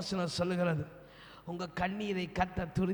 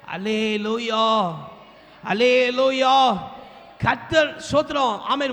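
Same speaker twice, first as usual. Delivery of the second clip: full-force preaching; 4 semitones higher, 11 dB louder than usual. Acted. Preacher assuring listeners God will protect them, full-force preaching. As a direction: thunder God's promise at the congregation.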